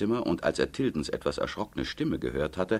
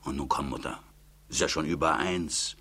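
usual speaking voice

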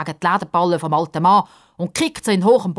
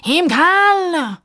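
very high, loud, stretched shriek of dismay; horrified gasp; 6 dB louder, much slower than usual. A narrator's reading. Sidekick appalled at living in the cellar, horrified. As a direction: wail it in horror. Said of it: "japst der Justus entsetzt".

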